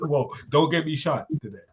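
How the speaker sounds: ordinary talk, nothing marked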